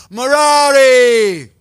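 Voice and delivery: long, high, booming shout; thunderous call. A narrator's reading. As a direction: bellow the name, drawn out and high. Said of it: "in a very thunderous voice".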